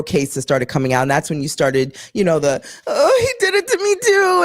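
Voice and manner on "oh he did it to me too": high, hammed-up weepy whine; mock tearful accuser, pitched way up; mocking sob. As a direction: parody a fake crying victim.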